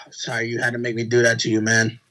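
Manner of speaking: low, subdued imitation of Roman; Roman's remorseful reproach. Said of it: "he was looking like".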